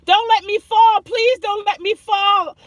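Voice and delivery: high, loud frightened pleading; retold hollering. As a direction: beg them not to let you fall, screaming. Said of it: "I was screaming out, hollering to the people".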